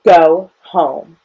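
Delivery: loud, firm dismissal at normal pitch; red-faced doctor's loud command; angry order. A narrator's reading. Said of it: "His face turned red".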